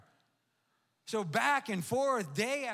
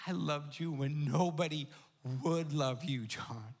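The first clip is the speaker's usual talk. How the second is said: lower, tender voice of God; voice of the Lord; 6 semitones lower than usual. Acted. The Lord speaking to pastor John through the dog, low and tender. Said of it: "he goes".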